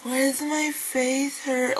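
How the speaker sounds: high, drawn-out soft whine; film girl imitation. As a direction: imitate her in a slow, high voice.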